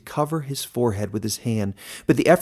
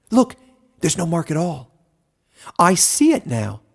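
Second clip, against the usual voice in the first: excited animals; 6 semitones higher, 5 dB louder than usual. A narrator's reading. Excited animals inspect Caleb.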